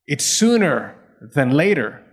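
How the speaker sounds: normal talking voice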